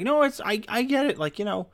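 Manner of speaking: high, earnest mock little girl; little sister calmly explaining to mom; kid sister voice. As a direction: pipe it up like a knowing kid. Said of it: "my sister's like".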